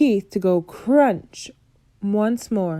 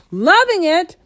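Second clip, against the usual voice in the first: much higher, loud outraged yell; furious yell; 10 semitones higher, 7 dB louder than usual. A narrator's reading. Bloodbottler yelling at the BFG's innocent question, outraged. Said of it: "yelled the blood bottler".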